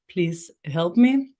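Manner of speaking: ordinary delivery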